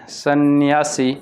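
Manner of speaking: सन्यासी slowly, drawn out; spelling demonstration. Shown as a demonstration. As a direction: stretch सन्यासी into "san-yaa-see". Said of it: "ऐसे होता है".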